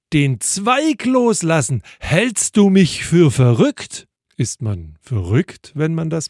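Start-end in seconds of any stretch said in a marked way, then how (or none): 0.1-4.1 high, loud incredulous outcry; storyteller's raised, high-pitched cry of despair; desperate shout. A narrator's reading. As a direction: shout it back in disbelief. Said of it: "Der verzweifelte Mann schreit".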